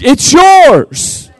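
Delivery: loud Southern preacher shout; full-throated preacher shout. Real, not acted. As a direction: belt it to the back pews.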